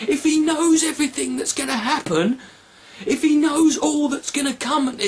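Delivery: normal talking voice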